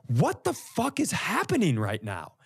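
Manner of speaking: ordinary talk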